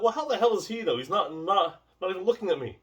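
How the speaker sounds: high-pitched, incredulous inner complaint; mock exasperation at the teacher; baffled protest. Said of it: "I'm like".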